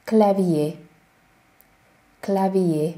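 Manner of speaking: clavier as klavie, silent R; French clavier. Shown as a demonstration. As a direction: end clavier on ié. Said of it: "az R-et nem ejtjük itt".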